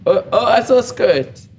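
high, loud excited burst; excited exclamation. Real, not acted. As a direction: blurt it out, thrilled.

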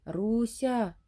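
calling out as if through a radio; a distant, crackly voice shouting a name; distorted far-off call. A narrator's reading. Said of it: "спотворений, неначе з радіоприймача голос".

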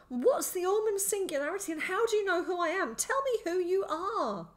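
high, loud, frantic questioning; demanding. A narrator's reading. Boy demands the stranger explain himself, frantic. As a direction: press for answers, slowing on the last order. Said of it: "I demanded".

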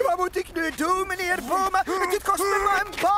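desperate whine; panicked pleading. Acted. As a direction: beg in a panic for his job. Driver fears losing his job.

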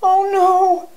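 high, fearful wail; frightened cry. A narrator's reading. Wolf looks down from the treetop, crying out afraid. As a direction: cry out in fear, pitched right up. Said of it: "he cried".

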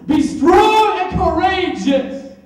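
high, loud and slow shouted charge; shouted command. Acted. God insisting his son be brave, shouted command. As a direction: bellow it out as a command. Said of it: "didn't I tell you?".

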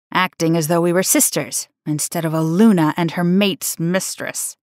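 plain reading voice

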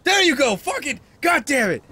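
high, loud outburst of relief; exasperated triumph. Real, not acted. Player finally gets it, exasperated triumph. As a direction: shout it, vindicated and fed up.

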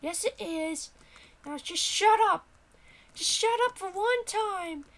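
high-pitched, exasperated character; indignant high squeal; fed-up sidekick voice. Acted. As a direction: shut the player up in a high voice. Character silences the player.

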